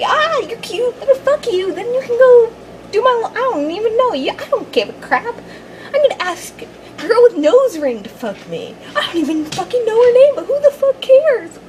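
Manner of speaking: high hammed-up careless womanizer; sleazy playboy mocking his conquests; mock sleazy player.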